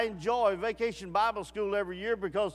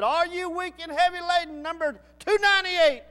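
loud, higher pulpit call; mock preacher's call; 8 semitones higher, 8 dB louder than usual. Church preacher calling out to the congregation, loud. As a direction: ring it out like a revival preacher.

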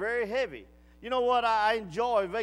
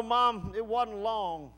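ordinary delivery

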